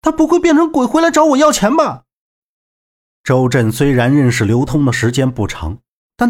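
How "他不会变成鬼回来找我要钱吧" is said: very high, fretful wail; high-pitched comic dread; exclaiming in dismay. A narrator's reading. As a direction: worry aloud about being haunted. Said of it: "连声不禁感慨道".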